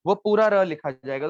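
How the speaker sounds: ordinary talk, nothing marked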